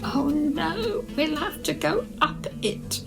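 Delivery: usual speaking voice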